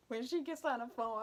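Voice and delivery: normal talking voice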